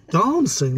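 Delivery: "Dancing" said as "Dahncing"; posh long A. Shown as a demonstration. Wife mocks posh speech, "Dancing" as "Darncing". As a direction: stretch "Dancing" into "Darn-sing". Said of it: "that's like darning in the sea".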